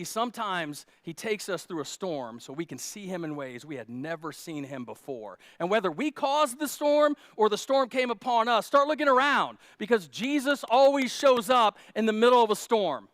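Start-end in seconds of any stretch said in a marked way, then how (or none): 5.6-13.1 loud, driving preacher's build; full-force preaching. Real, not acted. Preacher urging the church to look for Jesus, loud and driving. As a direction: preach it home to the congregation.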